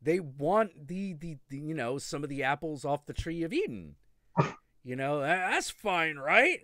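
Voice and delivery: chirpy, self-satisfied dealmaker. Retold or imitated. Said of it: "they were like".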